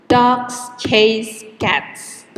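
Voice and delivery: slow, with DOGS, CHASE and CATS each hit hard; stressed rhythm. Shown as a demonstration. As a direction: tap out three even beats on Dogs, chase, cats. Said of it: "the time between stressed words is always the same".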